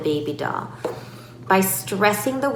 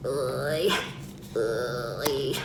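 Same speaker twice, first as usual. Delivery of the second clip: low, soft effortful grunt; mock straining noises, low and drawn out; 7 semitones lower, 8 dB quieter than usual. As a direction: pretend to struggle with a tight lid. Pretend straining to open the tub.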